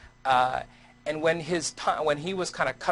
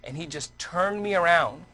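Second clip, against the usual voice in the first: usual speaking voice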